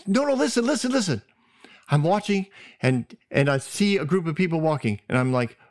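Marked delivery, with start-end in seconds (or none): 0.1-1.2 high, urgent repeated plea; insistent pleading. Retold or imitated. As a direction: beg her to just listen. Sister insisting mother listen.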